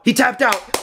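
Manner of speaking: loud, high shout of victory; real elated outburst; thrilled shout. Real, not acted. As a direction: yell it out as your fighter wins. Fighter wins by submission.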